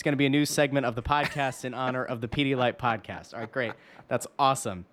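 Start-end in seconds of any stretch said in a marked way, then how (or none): none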